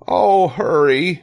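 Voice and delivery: high, loud, drawn-out urging; urgent plea. A narrator's reading. Wilbur urges the rat, urgent plea. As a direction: press him in a high voice.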